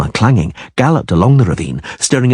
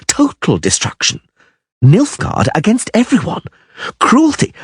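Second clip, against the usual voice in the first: higher, some words slowed in dread; horrified bard; 6 semitones higher than usual. Acted. Dandelion describing Nilfgaard's cruelty to Geralt, higher dread. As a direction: paint the horror to him.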